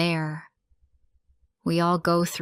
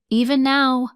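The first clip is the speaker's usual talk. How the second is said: higher and louder, the words bursting out; emotional outcry; 7 semitones higher, 7 dB louder than usual. A narrator's reading. Narrator remembering the lost girl, a raised anguished cry. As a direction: cry it out as the grief peaks. Refrain repeated at the emotional peak.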